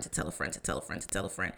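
whispered echo. Pretend. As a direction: breathe the repeats like a dying echo. Whispered echo of her own phrase.

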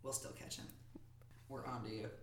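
hushed ominous threat; menacing whisper. Acted. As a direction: whisper it like a warning. Mock threat to killer.

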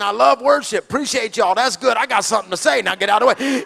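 loud and eager, bursting to speak; fired-up preacher who can't wait his turn; caged lion. Retold or imitated. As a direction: push past everyone to get the mic. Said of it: "I'm like a caged lion".

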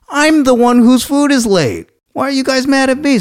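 high, loud exasperated protest; indignant outburst. Retold or imitated. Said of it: "I got so mad".

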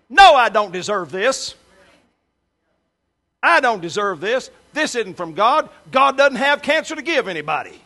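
loud, high, defiant declaration; loud defiant refusal of the sickness. Retold or imitated. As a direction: shout it back with faith, rising. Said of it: "she said out loud with her mouth".

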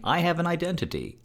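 lightly voiced defiant woman; mock young woman. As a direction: parody her declaring herself. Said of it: "because she's saying".

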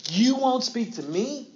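high, loud, slow indignant demand; indignant Pilate. Acted. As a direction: stress on "You" in "You won't speak to me?", pitched high and loud. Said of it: "That's the emphasis we need to put on it".

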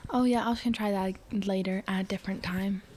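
whispering. Real, not acted. Aside to co-host.

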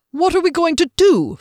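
high, loud worried question; panicking about what next; anxious. A narrator's reading.

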